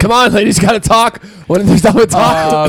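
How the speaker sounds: high, playful call to the group; teasing hurry-up. Pretend. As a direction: chivvy them along in mock impatience. Teasingly hurrying the others along.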